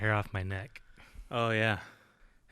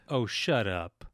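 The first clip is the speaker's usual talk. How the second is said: ordinary delivery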